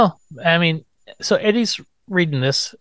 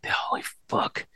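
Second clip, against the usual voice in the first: stunned whisper; 10 dB quieter, slower than usual. Retold or imitated. Principal's stunned reaction.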